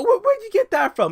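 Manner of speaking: you can't believe they said it; incredulous outburst. Real, not acted. Indignant disbelief at accusation.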